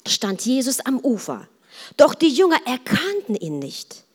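ordinary delivery

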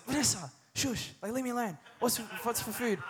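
short-fused husband brushing her off, lightly acted; irritable snap. Husband snaps at his wife from back pain, mock irritable. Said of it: "I'm just like".

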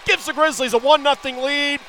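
high and loud, still riding the goal; excited goal call. Real, not acted. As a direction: keep the thrill going after the score.

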